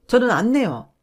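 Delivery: 크게 또박또박 못 박듯; 물러서지 않겠다는 듯 힘주어; 단호한 거절. Acted. 시어머니에게 단호히 거절. (시어머니에게 이자를 못 낸다고 단호히 말하는 며느리)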